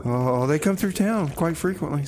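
ordinary talk

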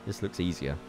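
ordinary talk, nothing marked

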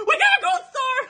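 extremely high squeal, cracking with excitement; overjoyed squeal breaking into laughter. Real, not acted. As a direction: let the voice crack on a shriek. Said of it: "my voice cracked".

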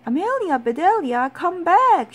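high, loud calling after someone; shouting. A narrator's reading. Boys calling back the runaway runner, high loud shout. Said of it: "shouted the boys".

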